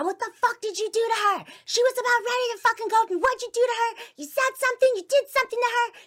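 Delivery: high-pitched, very loud accusing yell. Retold or imitated. Mom accusing dad of turning the child, yelling. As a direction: imitate mom screaming at dad. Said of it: "She starts yelling at my dad".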